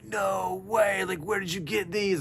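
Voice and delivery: amazed. Retold or imitated. Ebony amazed by the gift.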